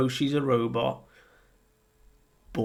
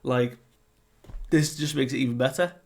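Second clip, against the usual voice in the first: higher, faster, gushing mock-excited voice; excited Luffy; 4 semitones higher, 4 dB louder than usual. Luffy is amazed the robot talks, hyped and fast. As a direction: rattle it off like a thrilled kid. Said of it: "And Luffy's like".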